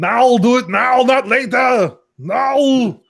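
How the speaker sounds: put-on high, slow, loud Austrian accent; hammed-up Austrian action-hero yell; Arnold.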